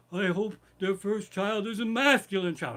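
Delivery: imitated henchman, loud and high; Lou Gabrazzi. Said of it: "he's close talking to him".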